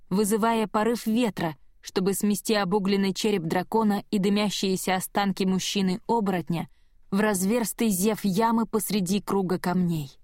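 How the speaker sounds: plain reading voice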